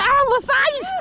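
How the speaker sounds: high, delighted exclamation; excited. Real, not acted. Fisherman admiring a big catch, excited.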